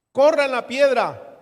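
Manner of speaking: loud, slow commanding order; Jesus commanding. Acted. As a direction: boom out the command. Jesus commands the stone removed. (Jesús ordenando fuerte y despacio: "Corran la piedra")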